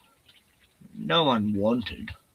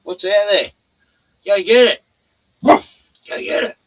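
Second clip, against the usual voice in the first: louder, higher go-get-it call; egging on the dog; 6 semitones higher, 9 dB louder than usual. Real, not acted. Owner sends Bruno after something, loud and high. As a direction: sic him on it, loud and high.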